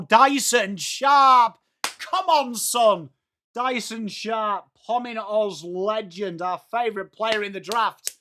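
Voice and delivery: excited play-by-play, stretching the scorer's name; live goal call. Real, not acted. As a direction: build to the goal, then celebrate it.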